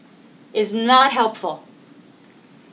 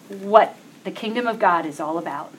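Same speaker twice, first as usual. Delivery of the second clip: ordinary delivery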